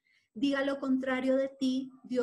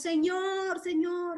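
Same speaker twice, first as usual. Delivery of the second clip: high, louder calls of Señor; pleading cry; 6 semitones higher, 5 dB louder, much slower than usual. Acted. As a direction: reach up to God in need.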